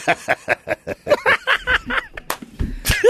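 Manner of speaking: high-pitched evil cackle; sinister laugh. Pretend. Finishing the villain audition with a high evil cackle. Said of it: "a sinister laugh".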